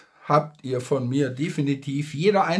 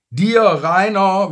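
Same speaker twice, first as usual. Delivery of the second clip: calling out; 6 semitones higher, 9 dB louder, slower than usual. Real, not acted. Calling out to Drachenlord directly.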